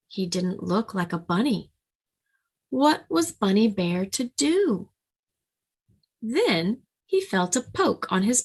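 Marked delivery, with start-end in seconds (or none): none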